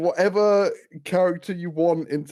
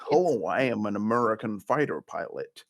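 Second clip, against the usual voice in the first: low mock American accent; generic American accent; 5 semitones lower, 4 dB quieter than usual. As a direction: imitate an English actor's stock American accent. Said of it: "one really generic American accent".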